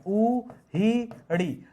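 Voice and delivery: "उहिड़ी" broken into slow, separate syllables; sounded out slowly. Shown as a demonstration. Teacher spelling out a word for students, slow syllable by syllable. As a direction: draw out each syllable of "उहिड़ी".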